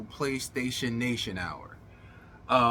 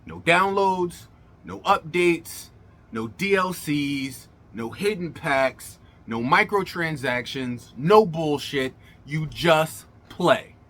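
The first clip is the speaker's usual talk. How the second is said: slow, punchy list building to loud; emphatic slow list; 3 dB louder, slower than usual. Real, not acted. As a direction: hammer each no, then slow down.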